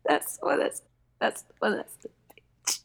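unvoiced gossipy whisper. Real, not acted. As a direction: whisper it like a secret. Gossiping aside about the Chipmunk.

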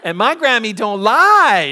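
put-on high, loud child voice breaking into laughter; little boy voice, laughing. Brady insists to the teacher, high, loud and laughing. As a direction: deliver the punchline like a proud little kid.

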